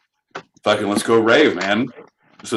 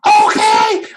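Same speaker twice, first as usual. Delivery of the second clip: shout; 6 dB louder than usual. Acted. Sudden yell after hush joke.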